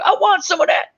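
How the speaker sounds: mock eager squeal. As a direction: jump at it like a kid wanting candy. Retelling eager movie character.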